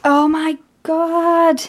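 high, long-drawn disbelief; amazed delight. Real, not acted. Gift opener sees two wrapped albums, amazed. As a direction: stretch each word out in high-pitched awe. Reveals another wanted album.

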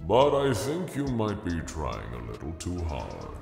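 measured, unimpressed put-down; almost bored. Acted. Stranger belittles Bill's effort, almost bored. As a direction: needle him with mild, unbothered calm. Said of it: "sounding almost bored".

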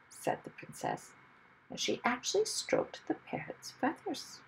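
ordinary delivery, nothing marked

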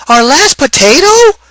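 high, loud alarmed cry; exclaiming. A narrator's reading. Wife hears they have only one potato left, a high alarmed exclaim. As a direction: gasp it out in alarm. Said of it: "exclaimed Missus O'Grady".

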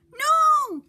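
high, loud anguished scream of no; a child's high toy-voice scream; shout. Acted. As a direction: scream it to stop her. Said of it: "shouted Percy".

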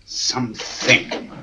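slow whispered thought; whispered musing. Acted. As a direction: muse to yourself under breath. Musing over the desk.

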